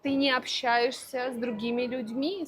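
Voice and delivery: usual speaking voice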